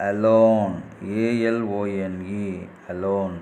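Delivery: slow, clear spelling-out dictation. Acted. Teacher dictating a story sentence to the class, slow and clear. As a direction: read each word, spell it, say it again.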